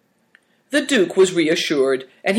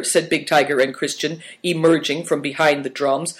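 plain reading voice

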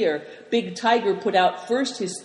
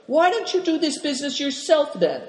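sly question; 9 semitones higher, faster than usual. A narrator's reading. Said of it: "eyeing him slyly".

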